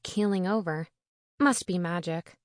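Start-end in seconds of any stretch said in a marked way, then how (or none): none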